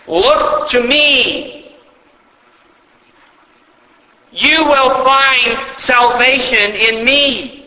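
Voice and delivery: God's call. Acted. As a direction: call them gently but firmly to look up. Preacher voices God's call.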